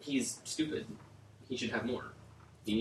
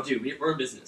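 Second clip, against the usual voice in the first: ordinary talk, nothing marked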